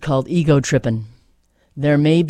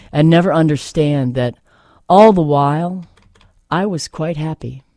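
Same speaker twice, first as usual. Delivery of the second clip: steady, earnest recital with weight on each line; poem reading; 7 dB louder than usual. Acted. Host reading a Nikki Giovanni poem to listeners, earnest recital. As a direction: read it as a poem you believe in.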